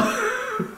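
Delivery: high, breathy horse-like laugh; wheezing laugh. Real, not acted. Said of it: "I sound like a dying horse".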